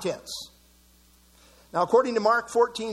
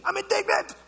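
mock indignant; 11 semitones higher than usual.

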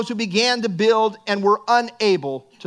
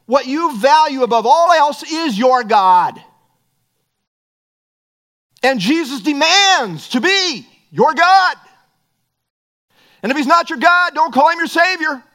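full-force preaching; 5 semitones higher, 7 dB louder than usual. Acted. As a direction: drive each phrase home to the congregation.

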